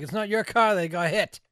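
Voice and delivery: retold heated protest; indignant retort. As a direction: throw it back at the manager, loudly. Retold angry retort to manager.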